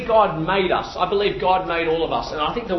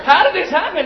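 laughing disbelief; 9 semitones higher than usual. Retold or imitated. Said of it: "And I'm like".

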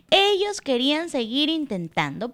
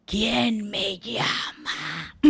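slow, hushed, lower sly fox; slow stealthy fox voice; sneaky fox; 5 semitones lower, 4 dB quieter, much slower than usual. Acted. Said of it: "sigiloso como es él".

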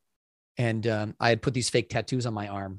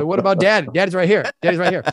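loud, higher comic plea; mock pissed; 7 semitones higher, 10 dB louder than usual. Dad wanting his son's attention, mock pissed. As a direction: protest like a jokingly slighted dad. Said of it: "I'm a little pissed".

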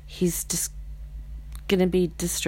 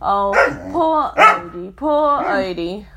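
mock sympathy; 7 semitones higher, 6 dB louder than usual. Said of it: "should probably give you some empathy".

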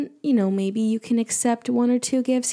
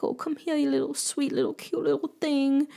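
put-on soft, slightly higher baby-talk coo; affectionate cooing; 3 semitones higher, 4 dB quieter than usual. Taller girlfriend giving a forehead kiss, cooing affectionately. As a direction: coo sweetly over someone little. Cooing over a shorter boyfriend.

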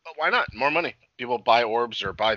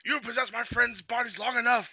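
high-pitched mock confrontation; put-on high dramatic challenge; acted hero line; 13 semitones higher, 4 dB quieter than usual. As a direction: imitate a hero confronting the villain in a raised voice. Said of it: "he's like".

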